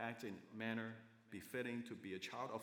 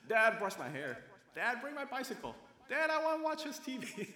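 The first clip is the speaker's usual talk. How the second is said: put-on high, rising little-girl pleas, louder as they go; demanding little girl; 11 semitones higher, 7 dB louder than usual. Little girl asking her dad for things, high and insistent. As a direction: imitate a child pestering Dad in a high voice. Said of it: "she would just be asking for her dad".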